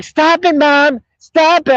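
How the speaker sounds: high-pitched whiny imitation of her; mock giggly daughter. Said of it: "she started giggling and shit".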